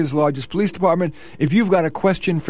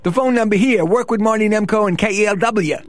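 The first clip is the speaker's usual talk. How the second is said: put-on Bronx accent for a radio plug; 2 semitones higher than usual. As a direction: lay on a streetwise Bronx accent, pitching to listeners. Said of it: "I'm using my Bronx accent".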